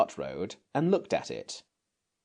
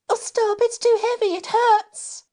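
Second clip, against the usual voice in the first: high, louder, pained cry; 6 dB louder than usual. Acted. Jane buried in gold, crying out in pain. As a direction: cry out in panic under the weight. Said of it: "she cried out".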